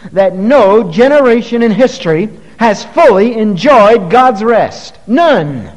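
preacher denouncing grumbling Israel; preaching at full force. Acted. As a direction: hammer the point home.

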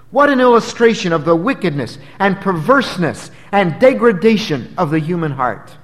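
fervent sermon build; preaching. Acted. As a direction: drive the point home from the pulpit. Preacher at full force.

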